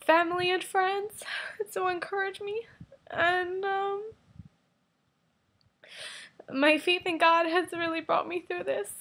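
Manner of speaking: thankful through real tears; weeping softly while speaking of faith; crying. Real, not acted. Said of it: "stop crying".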